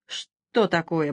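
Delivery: usual speaking voice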